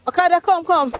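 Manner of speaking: high-pitched hailing call. Real, not acted. Passenger hailing an okada, calling out high. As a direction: summon a bike from down the road. Hails a motorbike taxi.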